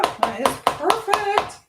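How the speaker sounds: drawn-out, high and pleased. Real, not acted. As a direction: savour the word perfect. Admiring finished coleslaw mix.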